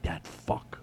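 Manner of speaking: hushed, nearly voiceless curse; grumbled aside; muttered grumble. Retold or imitated. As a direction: mutter it low under your breath. Imagined grumbling reaction of others.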